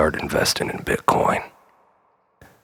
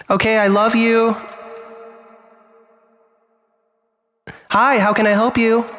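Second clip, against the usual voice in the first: very high, bright customer-service voice; hammed-up falsetto front-desk girl; chirpy receptionist. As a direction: greet every customer like a perky clerk.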